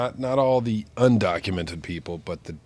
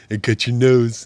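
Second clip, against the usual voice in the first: higher, louder goofy stuffed-nose voice; silly nasal voice; 5 semitones higher, 11 dB louder than usual. Pretend. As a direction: play a pinched-nose joker. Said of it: "somebody's pinching my nose".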